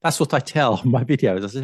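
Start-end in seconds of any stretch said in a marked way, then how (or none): none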